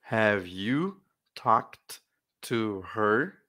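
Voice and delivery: "Have you talked to her?" stretched out very slowly; very slow model reading. Shown as a demonstration. Teacher drilling the question very slowly so it sinks in. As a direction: drag each word out so it sticks. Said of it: "só pra pegar firme".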